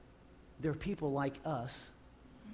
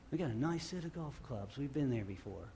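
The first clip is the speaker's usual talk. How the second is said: wry aside; 7 dB quieter than usual. Real, not acted. Wry aside, self-mocking.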